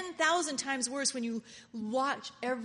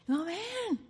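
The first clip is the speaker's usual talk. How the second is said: higher, louder mock fright; mock alarm; 6 semitones higher, 4 dB louder than usual. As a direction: squeal like scared of change. Voicing scared believer.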